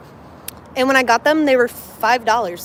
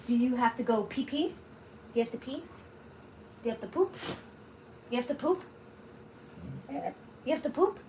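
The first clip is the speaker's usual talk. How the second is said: much softer coaxing question to the puppy; 13 dB quieter than usual. Real, not acted. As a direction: coo at the puppy, very soft. Asks puppy if he needs potty.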